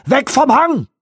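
high, loud command; shouted order. Acted. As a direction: keep yelling to pull back. Bormann keeps shouting orders.

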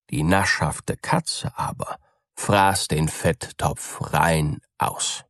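ordinary delivery, nothing marked

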